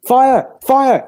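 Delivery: loud, high alarm cry; false alarm shouted in the street; shouting fire. Acted. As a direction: yell it to rouse the house.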